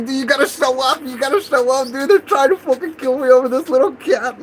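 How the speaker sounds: high, urgent appeal; retold desperate plea. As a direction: act out frantically calling for help. Retelling frantic call to news.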